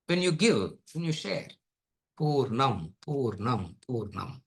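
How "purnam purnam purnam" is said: fading repetition. Acted. Repeats the word, fading away.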